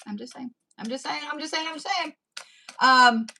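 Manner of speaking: normal talking voice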